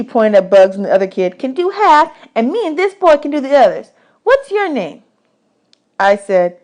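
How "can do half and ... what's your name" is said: put-on much higher, bossy little girl. Desi quizzing new boys about dishes, high bossy girl voice. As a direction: boss the new boys around.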